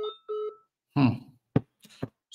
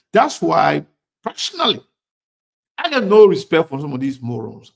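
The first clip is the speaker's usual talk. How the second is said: angry; 3 semitones higher, 7 dB louder than usual. Real, not acted. Host attacks critics.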